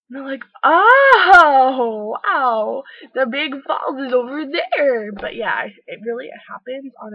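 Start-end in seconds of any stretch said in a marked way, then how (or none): 0.6-5.1 very loud, high, slow gushing "Oh, wow"; amazed dumb tourist. Retold or imitated. Tourist amazed that the falls are right there, hammed-up. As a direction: ham up the tourist's wonder. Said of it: "Crazy tourists".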